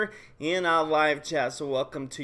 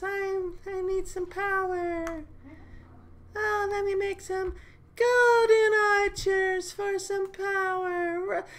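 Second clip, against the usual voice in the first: much higher, thin put-on girly voice, slow at the end; squeaky Peaches impression; 13 semitones higher than usual. Streamer mimics Peaches begging for more power, high squeaky voice. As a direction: pitch it way up like a cartoon girl begging for power. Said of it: "she would say it like this".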